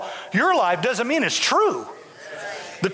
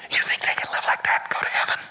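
quick scandalised whisper; a gossip whispering doubt about heaven; faster than usual. Retold or imitated. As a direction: hiss it like a judgmental church gossip. Mocking church gossips.

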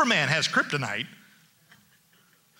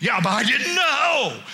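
whiny excuse; 4 semitones higher, 3 dB louder than usual. Retold or imitated. Members excuse, first take.

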